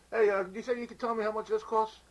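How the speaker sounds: high, pushy customer. Acted. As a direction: press the clerk up high. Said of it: "You are the customer".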